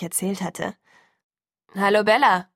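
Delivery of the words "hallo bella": loud greeting called from afar; calling out. A narrator's reading. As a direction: call hello into the room. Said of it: "rief Charlie". (laut rufend)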